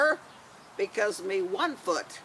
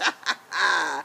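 hearty pirate laugh; 4 semitones lower than usual. Acted. Laugh after pirate quote.

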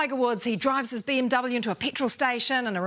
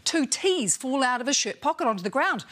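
ordinary delivery

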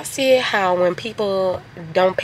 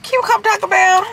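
high, sweet begging tone; sweet pleading voice; 9 semitones higher than usual. Retold or imitated. Daughter imitating calling a guy to come to Taco Bell, high and sweet. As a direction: coo it like asking a favor. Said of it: "Be like".

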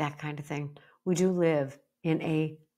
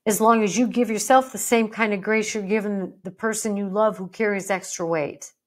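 ordinary talk, nothing marked

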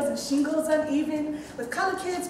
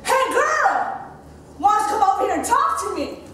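put-on high, loud catcall from a cocky boy; boy yelling; 5 semitones higher, 5 dB louder than usual. Street boy yelling at a passing girl, loud catcall. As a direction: holler it across the street at her. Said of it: "jumped out at me yelling".

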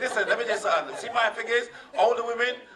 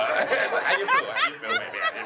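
mock coy lover. Comic voicing an older woman asking her lover how he feels, high falsetto. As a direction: coo it way up high like a flirty woman.

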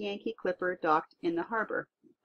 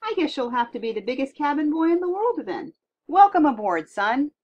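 higher, louder jovial sea captain; hearty captain; 7 semitones higher, 7 dB louder than usual. Acted. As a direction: boom a big welcome aboard. Captain welcomes giant boy.

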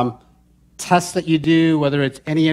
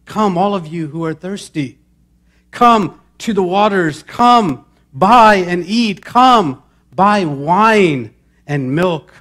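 rising to a loud, urgent call of come; fervent preacher's call, climbing to a shout; building proclamation; 4 semitones higher, 7 dB louder, slower than usual. Acted. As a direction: proclaim the invitation louder with each come. Preacher proclaims scripture invitation.

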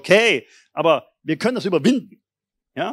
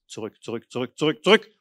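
building proclamation; 6 semitones lower than usual. Shown as a demonstration. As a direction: repeat zurück, growing stronger each time.

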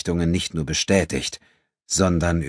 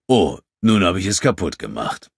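mock sorry, louder and theatrical; feigned regret; 4 dB louder than usual. A narrator's reading. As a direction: pretend to regret it while gloating. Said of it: "mit geheucheltem Bedauern".

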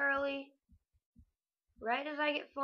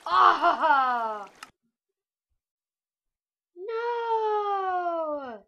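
sudden loud cry of dismay; shocked cry; 6 semitones higher, 9 dB louder than usual. Real, not acted. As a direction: yell out in real shock. Dying in game, cries out.

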